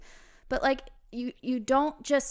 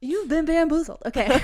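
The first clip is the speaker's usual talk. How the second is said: laughing; 7 semitones higher, 10 dB louder than usual. Real, not acted. Playful jab after announcer bit.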